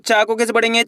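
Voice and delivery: ordinary delivery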